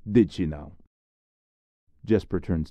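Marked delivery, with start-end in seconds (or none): 0.1-0.8 sceptical reply. Acted. Skeptical reply.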